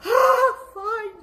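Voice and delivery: high, loud groaning give-in; exasperated groan. Acted. Man giving in to the rabbi with a high loud groan. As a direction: groan it like you can't bear it.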